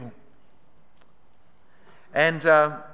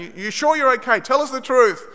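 high-pitched, insistent retold questioning; badgering; 7 semitones higher, 4 dB louder than usual. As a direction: press for an honest answer. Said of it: "they kept badgering me".

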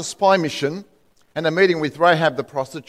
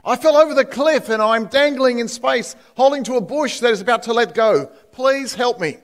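higher, urgent, frightened pleading; desperate plea; 6 semitones higher than usual. Acted. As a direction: beg for your life while hanging. Dangling man begs for help.

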